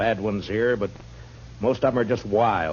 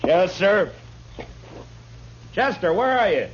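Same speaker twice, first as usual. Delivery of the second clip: calling out; 11 semitones higher than usual. Acted. Marshal calling for Chester out back, shouting. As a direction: call across the office, rising each time.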